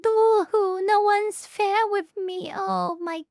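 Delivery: high, loud fake boo-hoo wail; hammed-up self-pity, whining in mockery; mock crying. Mocking her complaint that court isn't fair, fake sobbing.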